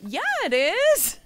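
put-on high, loud, drawn-out little girl retort. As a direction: shout back like a stubborn first grader. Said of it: "And this little girl goes".

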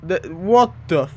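usual speaking voice

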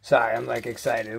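ordinary talk

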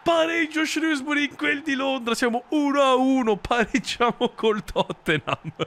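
high, thrilled commentator cry; excited celebration. Acted. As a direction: keep riding the goal euphoria. Celebrating the equaliser. (esultanza da telecronista)

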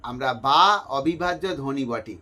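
ordinary delivery

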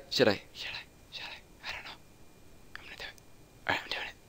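fading into a frightened whisper; scared whisper. Real, not acted. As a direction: talk yourself into it, barely voiced. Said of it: "Oh, I'm scared".

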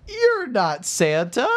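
put-on high, drawn-out child voice; mock-shocked little boy; shocked kid. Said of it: "And he says".

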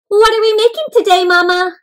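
put-on excited child.

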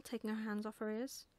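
ordinary delivery, nothing marked

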